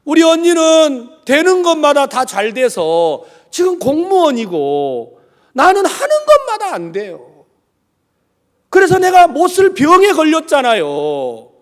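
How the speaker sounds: high, loud acted grievance; imitated loud, plaintive protest; complaining niece.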